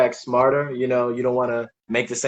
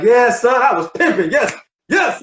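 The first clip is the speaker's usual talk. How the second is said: higher, playful pretend confession; mock-proud admission; 8 semitones higher than usual. Father jokingly answers his young son, mock-proud and high. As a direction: own up with exaggerated, high-pitched eagerness.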